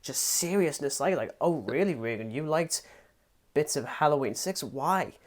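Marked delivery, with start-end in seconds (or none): 1.4-5.1 mock serious questioning. Co-host mocking him for sounding so serious. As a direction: parody your own grave interview style. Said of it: "so serious".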